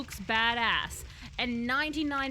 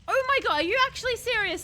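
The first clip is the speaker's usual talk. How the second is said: much higher, louder shocked outcry; outraged disbelief; 9 semitones higher, 7 dB louder than usual. Real, not acted. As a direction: react in loud disbelief. Learns kick needs certain weapons.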